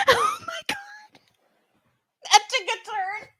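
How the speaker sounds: high, squeaky laugh-talk; helpless laughter through the words; laughing in disbelief. Real, not acted. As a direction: crack up while reacting, voice shooting up. Cracking up at the husband's threat.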